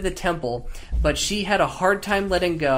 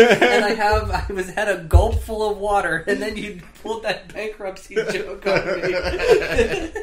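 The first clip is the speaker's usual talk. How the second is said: laughing; 6 semitones higher, 4 dB louder than usual. Real, not acted. Laughing at the bankruptcy joke.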